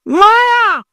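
high, drawn cry for mother; child's scream. Acted. Child waking mother in the night, high wailing call. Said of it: "আরেকটা চিৎকার মারছে".

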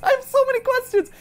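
high laughing disbelief; shocked. Real, not acted. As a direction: gasp out in surprise. Shocked by plot.

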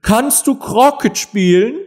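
high, slow shouted question; shouting queen. A narrator's reading. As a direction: fire the question as a domineering queen. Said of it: "schrie die Königin". (laut fragende Königin)

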